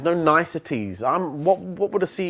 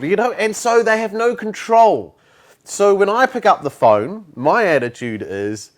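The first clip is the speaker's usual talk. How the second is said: ordinary delivery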